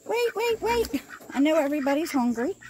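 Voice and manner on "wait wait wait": high calling voice. Real, not acted. Calling to hungry animals.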